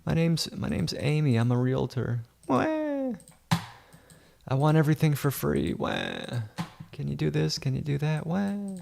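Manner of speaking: high, crying baby wah voice mocking the realtor; whiny Amy. Mocking the realtor Amy to viewers, high whiny baby voice. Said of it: "Little whiny Amy over here".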